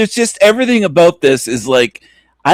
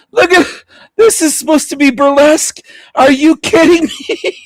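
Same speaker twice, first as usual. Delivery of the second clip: laughing in disbelief; 10 semitones higher than usual. Real, not acted. Laughing at absurd burlesque clip.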